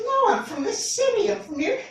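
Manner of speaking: high, huffy correction; indignant New Yorker. Retold or imitated. Offended stranger correcting him about the city, high and huffy. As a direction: squeak it out like an offended local.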